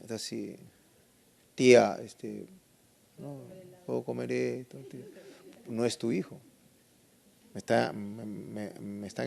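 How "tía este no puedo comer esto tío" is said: low, hesitant, very soft asking; timid house guest. Acted. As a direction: ask shyly, like a stranger in someone's home. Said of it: "cuando va un extraño a tu casa".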